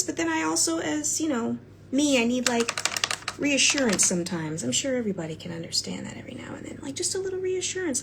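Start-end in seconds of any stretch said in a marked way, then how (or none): 4.6-8.0 whisper. Real, not acted. Confessing need for reassurance.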